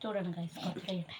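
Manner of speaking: mouth full of hot food. Real, not acted. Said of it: "എൻ്റെ നാക്ക് പൊള്ളി". (ചൂടുള്ള ഭക്ഷണം വായിൽ വെച്ച് സംസാരിക്കുന്നു)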